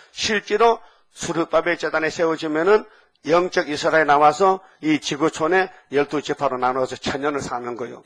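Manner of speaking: normal talking voice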